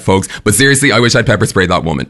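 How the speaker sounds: usual speaking voice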